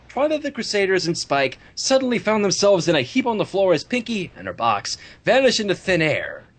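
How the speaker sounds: ordinary delivery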